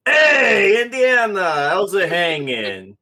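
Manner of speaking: booming, high-pitched shouted greeting; very loud. Acted. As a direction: holler hello at a hungover friend. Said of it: "his voice is so fucking loud".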